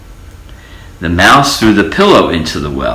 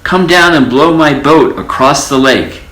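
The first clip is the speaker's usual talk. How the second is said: high, raised call; 8 semitones higher than usual. A narrator's reading. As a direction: mouse calling to the sky. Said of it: "shouted the mouse".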